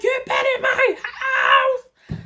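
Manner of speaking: high, slow, mocking yell; mock scream. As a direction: imitate her screaming at you, drawn out. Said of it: "She is screaming".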